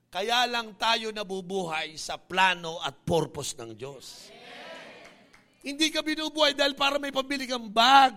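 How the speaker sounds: loud, fiery preacher to the congregation; full-force preaching. Acted. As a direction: preach it out to the whole church.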